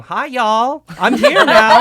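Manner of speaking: loud, high, laughing newborn greeting; laughing mock newborn. Mocking the idea of being born saying it, loud and laughing. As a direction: burst out like a baby announcing itself, giggling.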